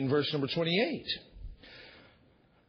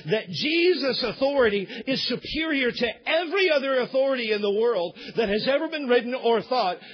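ordinary talk, nothing marked

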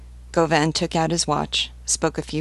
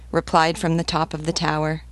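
ordinary delivery, nothing marked

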